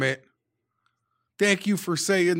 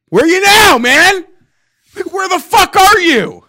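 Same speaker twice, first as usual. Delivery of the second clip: much higher and louder furious yell; angry shout; 13 dB louder than usual. Real, not acted. Fan screaming at the running back who fumbled, furious shout. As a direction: roar it at him, full rage.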